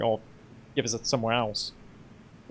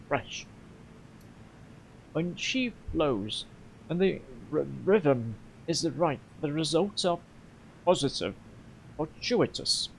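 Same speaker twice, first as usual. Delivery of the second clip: halting, weary. Acted. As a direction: pause between phrases as if hunting for words. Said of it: "his explanation is halting and weary".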